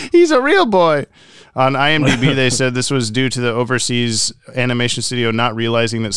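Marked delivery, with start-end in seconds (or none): 0.1-1.0 squeaky puppet voice. Pretend. Pinocchio quote.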